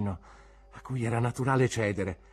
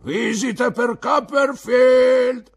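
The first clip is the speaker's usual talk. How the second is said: loud, high, slow call across the school; shouting; 12 dB louder, much slower than usual. A narrator's reading. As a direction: bellow out the visitor call. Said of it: "gridò nel suo solito modo". (grido forte e acuto, lento)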